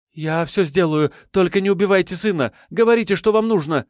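fast, high, desperate pleading; hurried. A narrator's reading. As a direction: rush it out, begging. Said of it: "торопливо ответила она".

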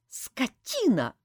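high, slow, hateful insult; hissed through teeth. A narrator's reading. Saeta glares at her partner, seething through teeth. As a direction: force it out through gritted teeth. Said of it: "процедила сквозь зубы Саэта".

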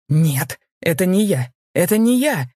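rising, loud desperate cry; frantic shout. A narrator's reading. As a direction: scream it as you are dragged away. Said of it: "кричал он". (отчаянный крик, всё громче и выше)

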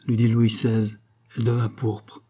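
plain reading voice

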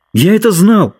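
выше, громче, с радостью; воскликни облегчённо; радостный возглас. A narrator's reading. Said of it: "воскликнул Ленни". (радостное восклицание)

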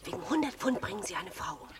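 low, soft whispered gossip; dark whisper. Acted. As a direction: murmur it like a grim secret.

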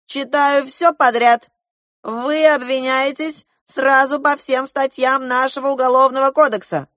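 high, loud accusation; blustering official indictment; angry general. A narrator's reading.

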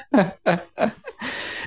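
short, high breathy laugh; a quick laugh of emotion; nervous laugh. Real, not acted. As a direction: laugh at yourself, overwhelmed. Laughing at his own emotion.

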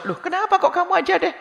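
high, startled "why?"; puzzled surprise. Retold or imitated.

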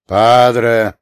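loud derisive echo of the word; scornful snort. A narrator's reading. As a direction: snort it back at him. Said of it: "старший фыркнул".

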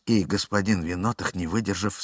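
normal talking voice

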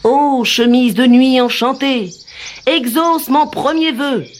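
high, slow invoking voice; solemn incantation. Acted. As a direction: chant it like a spell. Solemn magic invocation.

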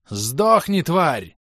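high, loud battle curse; enraged shout. A narrator's reading. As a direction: yell it with murderous rage. Enemy attacks in a rage.

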